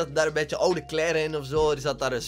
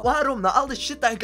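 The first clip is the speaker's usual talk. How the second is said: exasperated why; 8 semitones higher than usual. Real, not acted. Exasperated at the guys.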